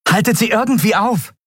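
high, pressing command; rally them to hold the door; captain ordering the crew; urgent order. A narrator's reading.

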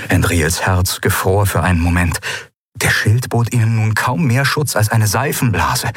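usual speaking voice

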